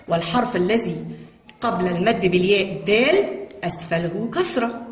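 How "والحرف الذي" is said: ordinary talk, nothing marked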